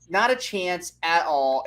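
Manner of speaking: ordinary delivery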